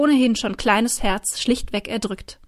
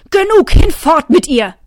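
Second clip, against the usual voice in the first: higher, loud furious roar; 6 semitones higher, 9 dB louder than usual. A narrator's reading. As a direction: explode at the guards. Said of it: "brüllte". (wütend brüllender König)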